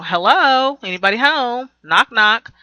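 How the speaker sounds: sarcastic high call, like knocking on an empty head; ridiculing the wife as if nobody's home; mocking hello. Vlogger mocking a cheated-on wife, sarcastic sing-song call.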